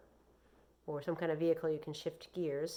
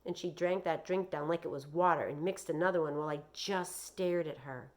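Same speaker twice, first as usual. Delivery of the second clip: ordinary delivery, nothing marked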